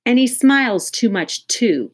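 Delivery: Kathy calls after the doctor; calling out. Acted. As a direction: shout it as the door shuts. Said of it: "Kathy called".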